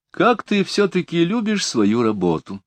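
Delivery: high, mocking jab at a colleague; sly mocking remark; snide. A narrator's reading. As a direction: tease him with a sly sneer. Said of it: "ехидно заметил сэр Джуффин".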